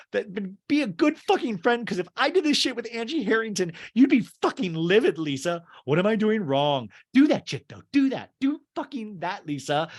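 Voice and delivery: high, heated imitation of a quarrel; re-enacted angry rant. Acting out Jen challenging Lisa's loyalty, angry rant.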